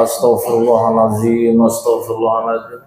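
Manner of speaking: man regretting he didn't skim the money; mock istighfar. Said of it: "Langsung dia istighfar".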